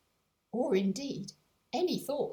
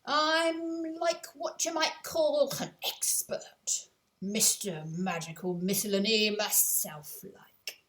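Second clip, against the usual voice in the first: louder, slow, self-important working-class man; pompous shopkeeper George; 5 dB louder, slower than usual. Acted. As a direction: puff up and announce yourself grandly. George boasts to Cynthia.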